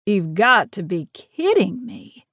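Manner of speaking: slow, disbelieving Southern drawl; lightly Southern-accented disbelief. A narrator's reading. Jet's drawl, disbelieving attacker.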